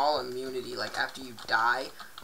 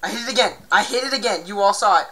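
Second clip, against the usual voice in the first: indignant insisting; 6 semitones higher, 13 dB louder, faster than usual. Real, not acted. Insists to viewers.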